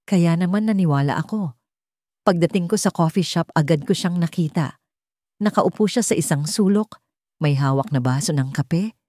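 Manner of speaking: usual speaking voice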